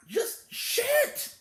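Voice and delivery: exasperated yell. Real, not acted. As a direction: let the frustration burst out. Ranting about the movie.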